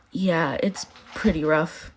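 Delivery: slow, soft sighing line. A narrator's reading. Mina admitting nightmares to friends, sighing. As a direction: let it out on a sigh. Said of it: "sighs Mina said".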